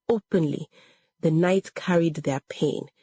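ordinary delivery, nothing marked

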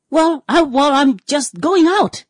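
high, slow, hesitant mock excuse; sheepish excuse. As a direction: stall like a caught-out friend, pitched high and slow. Friend making a sheepish excuse.

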